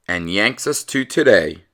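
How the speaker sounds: usual speaking voice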